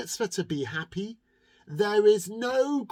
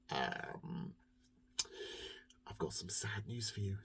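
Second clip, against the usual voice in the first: much softer, whispered bad news; sad baker whisper; 8 semitones lower, 12 dB quieter than usual. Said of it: "And the baker said".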